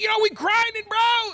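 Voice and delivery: bro voice. Retold or imitated. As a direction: gush like an overfriendly gamer bro. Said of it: "He's like".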